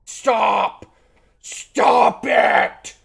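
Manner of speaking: high, loud yell at the screen; frustrated shout. Real, not acted. Yelling at glitching game.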